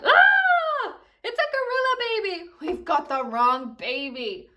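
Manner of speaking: high loud scream fading to a slow groan; yelling. Acted. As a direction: shriek in shock, then sink. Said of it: "they both yelled".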